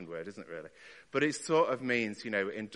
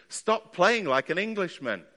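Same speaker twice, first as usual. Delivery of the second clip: piano teacher shouting from the other room; raised, higher-pitched mock shout at a pupil; imitated shouting; 7 semitones higher, 6 dB louder than usual. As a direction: call out an exasperated order, loud and high. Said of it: "he would come shouting in".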